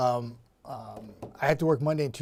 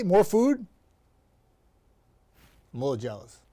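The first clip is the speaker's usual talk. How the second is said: usual speaking voice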